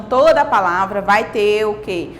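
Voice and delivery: ordinary talk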